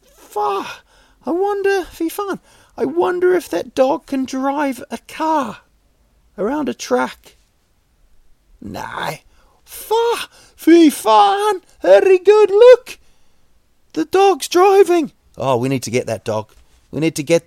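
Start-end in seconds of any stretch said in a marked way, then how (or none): 0.0-7.4 much higher, squeaky put-on voice of a gullible viewer; silly high voice of someone impressed by a driving dog; mock amazed viewer. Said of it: "than you're watching and thinking". 9.6-15.1 much higher and louder, hammed-up squeals of delight; over-the-top high-pitched amazement at the dog driving; mock excited gush. Hamming up the awed viewer.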